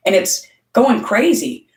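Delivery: usual speaking voice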